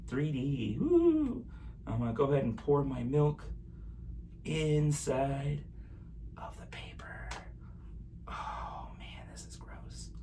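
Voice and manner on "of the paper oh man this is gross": hushed whisper. Real, not acted. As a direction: whisper it under breath. Whispering while pouring milk.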